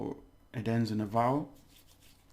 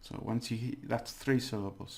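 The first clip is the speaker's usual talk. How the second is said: normal talking voice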